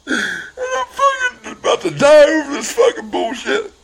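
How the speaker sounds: very high, tearful outcry; real crying. Real, not acted. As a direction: wail the words through sobs. Overwhelmed while telling the story.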